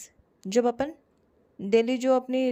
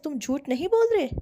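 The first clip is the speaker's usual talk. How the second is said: higher and louder, pointed accusation; accusing tone; 4 semitones higher, 5 dB louder than usual. Shown as a demonstration. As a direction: make it a charge, not a question. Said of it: "बोलते ना अपन".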